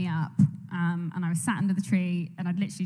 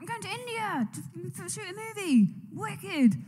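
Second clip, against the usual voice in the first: excited; 10 semitones higher, 5 dB quieter, slower than usual. Retold or imitated. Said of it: "I was like".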